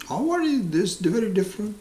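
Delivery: hammed-up incredulous questioning; psychiatrist reasoning.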